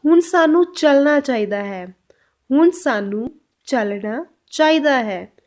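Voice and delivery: slow, deliberate Punjabi sentence for learners; slow Punjabi model. Shown as a demonstration. Teacher to students, a slow, clear Punjabi model line. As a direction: spell out each word of हुण सानू slowly. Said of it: "इसको पंजाबी में कैसे बोलेंगे?".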